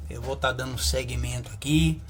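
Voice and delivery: usual speaking voice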